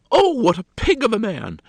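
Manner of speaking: high, loud burst of exasperation; fuming. A narrator's reading. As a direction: exclaim in a hammed-up fury. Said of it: "in a great fume with her".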